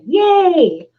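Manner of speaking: high fake cheer; mock delight; sarcastic yay. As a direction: celebrate with dripping sarcasm.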